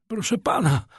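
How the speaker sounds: high, urgent hail, rising in force; calling out. A narrator's reading. Someone on the stairs hails the master, urgent calling. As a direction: call for the master from afar. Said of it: "ozwało się na schodach czyjeś wołanie".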